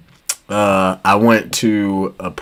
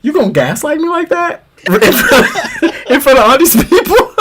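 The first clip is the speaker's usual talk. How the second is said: high, laughing mock outrage; laughing disbelief. Student laughing as he confronts his old teacher. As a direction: laugh through the accusation. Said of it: "And I was like".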